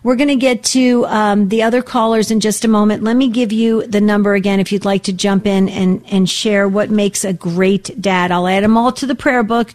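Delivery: ordinary talk, nothing marked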